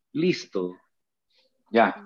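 ordinary delivery

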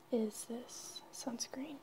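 usual speaking voice

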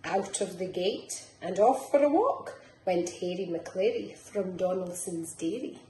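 lilting picture-book reading; reading to children. Acted. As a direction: bounce the rhymes along for little ones. Reads picture book aloud.

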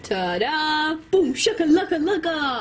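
high, proud showman reveal; triumphant ta-da. Acted. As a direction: announce it like a magic trick. Proud reveal of googly eyes.

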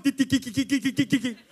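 rapid staccato tongue-clicking syllables; rattled-off nonsense syllables; runaway tongue. Retold or imitated. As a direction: imitate a tongue chattering out of control. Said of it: "my tongue's going".

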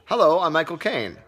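loud, high, mock impression intro; Michael Caine. Shown as a demonstration. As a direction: announce yourself loudly with barely any disguise.

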